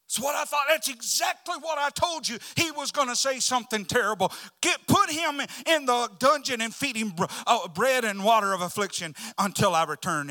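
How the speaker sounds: loud, high, indignant rant; enraged ruler shouting orders; furious king. Acted. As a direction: order him thrown in the dungeon. Angry king condemns the prophet.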